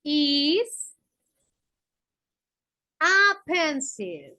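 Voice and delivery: slow, word by word answer; drawn-out classroom answer. Shown as a demonstration. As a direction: spell it out slowly for the class. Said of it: "la chica le está respondiendo".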